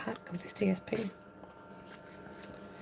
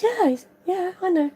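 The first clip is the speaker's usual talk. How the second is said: lightly voiced child, pitched higher; higher-pitched retold reply from a schoolboy; imitated child; 7 semitones higher, 4 dB louder than usual. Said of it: "And he said".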